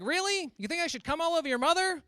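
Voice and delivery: high loud incredulous dad; dad horrified, voice jumping high; dad replying to son's rude joke, high and loud; shocked dad. Retold or imitated. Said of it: "He's like".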